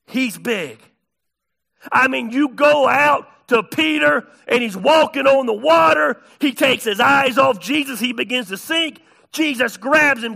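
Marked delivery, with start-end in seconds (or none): 1.9-10.4 loud, high-pitched sermon storytelling; full-force preaching. Real, not acted.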